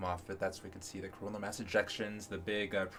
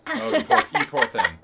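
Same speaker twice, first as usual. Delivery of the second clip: mock pity; 5 semitones higher, 12 dB louder than usual. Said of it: "mock pity for a friend".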